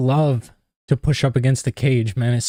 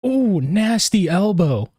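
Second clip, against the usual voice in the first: excited; 6 semitones higher than usual. Real, not acted. Reacting to a hard elbow.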